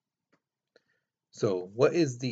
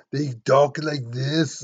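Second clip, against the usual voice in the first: mock thick Austrian accent, slower and louder at the end; 3 semitones higher, 7 dB louder, slower than usual. Shown as a demonstration. Teacher demonstrating how Austrians talk, hammed-up accent. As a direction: ham up an Austrian accent for the class.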